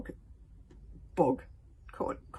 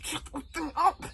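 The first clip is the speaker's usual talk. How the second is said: higher urgent hiss; bully hissing in fear; hissed order; 11 semitones higher, 5 dB louder than usual. Acted. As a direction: snap it in terror. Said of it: "hissed Snotlout".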